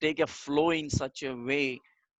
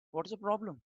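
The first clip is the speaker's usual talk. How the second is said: mock annoyed; 7 semitones higher, 6 dB quieter than usual. Mock annoyed slouching speaker.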